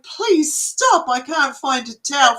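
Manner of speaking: a mock shout from a retold gross job; mock yell. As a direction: yell it in hammed-up disgust. Retelling disgust at sputum work.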